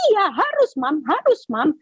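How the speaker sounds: son's voice pitched high, insisting; a pushy grown son pressing his mother; insistent son. Retold or imitated.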